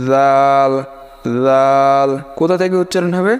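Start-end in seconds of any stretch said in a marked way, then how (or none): 0.1-2.1 দাল starting with a soft voiced th, drawn out; tongue tip on upper teeth for দাল; soft dhal. Shown as a demonstration. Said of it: "সায়ের মতো নরম হবে". (জিভ দাঁতে লাগিয়ে নরম যাল)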